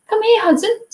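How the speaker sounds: high-pitched coaxing call to a pet; calling a dog. Real, not acted. Streamer to Hudson at the door, high-pitched dog call.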